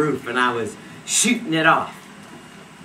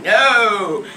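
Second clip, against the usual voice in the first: loud, raised retold no; emphatic refusal; 5 semitones higher, 9 dB louder than usual. Friends laughing off the gun idea, loud refusal. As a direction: blurt out a mock refusal.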